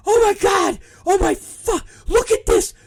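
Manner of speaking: imitating a high, loud shriek of horror; panicked shouting. Wrestler seeing her scalp in the mirror, panicked shouting.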